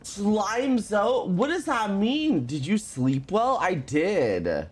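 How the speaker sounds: ordinary talk, nothing marked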